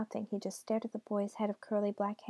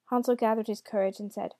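ordinary delivery

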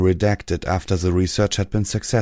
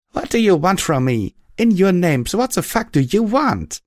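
much higher, louder desperate shout; rasping at the heavens; 12 semitones higher, 4 dB louder than usual. A narrator's reading. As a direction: hurl it at the sky in fury. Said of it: "rasp at the heavens".

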